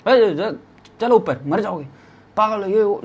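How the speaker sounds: scolding auntie. Retold or imitated. Auntie ordering trekkers back uphill, imitated scolding. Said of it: "किसी ladies से गाली खाई".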